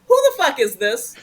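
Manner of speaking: mild mock hostility on the phone; suspicious snap. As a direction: caller claims a movie role. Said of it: "I literally said that to Bob".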